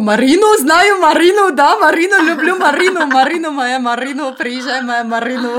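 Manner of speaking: put-on high, loud Tajik-accented lovesick man. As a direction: gush about Marina in a Tajik accent. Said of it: "какой-то таджикский, блин, акцент".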